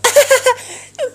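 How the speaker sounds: high-pitched mock laughing fit; imitated giggle. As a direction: imitate her giggling before she answers. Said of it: "ela começa a rir". (risada aguda imitada)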